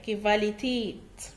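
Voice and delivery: كفاليتيت said slowly and clearly as kivalitet; slow model word. Shown as a demonstration. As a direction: drill كفاليتيت slowly for the class to repeat. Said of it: "حاول تكرر معايا". (نطق كفاليتيت ببطء للتكرار)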